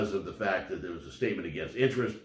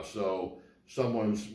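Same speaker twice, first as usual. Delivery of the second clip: ordinary talk, nothing marked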